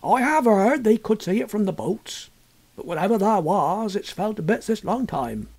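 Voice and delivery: put-on an old landlord passing on hearsay; lightly voiced village innkeeper; country landlord. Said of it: "Mister Betts agreed".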